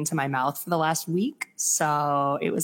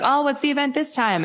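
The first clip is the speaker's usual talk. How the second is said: chirpy spray tanner; 9 semitones higher, 4 dB louder than usual. Retold or imitated. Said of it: "she's like".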